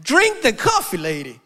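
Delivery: high, loud hammed-up "drink the coffee"; egging her on to take the sip; mock urging.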